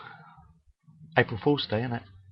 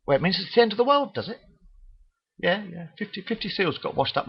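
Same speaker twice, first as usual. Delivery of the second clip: mocking gullible viewer; 9 semitones higher, 4 dB quieter than usual. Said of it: "You think".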